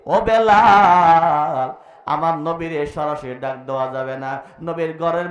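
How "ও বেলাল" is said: loud, very drawn-out call of a name. Acted. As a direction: stretch the name as you call him. Voice calls Bilal in story.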